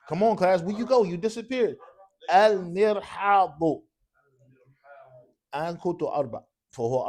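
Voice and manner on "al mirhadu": loud, heavily stretched syllables; very slow dictation. Shown as a demonstration. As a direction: call it out very slowly for the class to write. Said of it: "it's dictation in Arabic".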